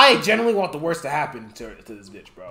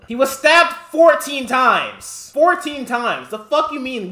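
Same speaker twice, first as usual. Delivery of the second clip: loud, slow, hammering each word; outraged; 6 semitones higher, 5 dB louder, slower than usual. Real, not acted. Streamer raging to chat about the stabbing, angry. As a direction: drive home the outrage.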